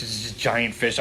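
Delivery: ordinary talk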